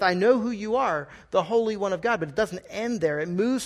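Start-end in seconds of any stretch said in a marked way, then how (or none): 0.0-2.2 higher, open and sincere; profession of faith. Shown as a demonstration. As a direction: say it as a believer confessing. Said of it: "if it was said in a different tone".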